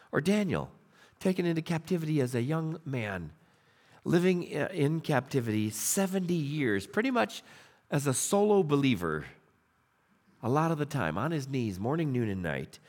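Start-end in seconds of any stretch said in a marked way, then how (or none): none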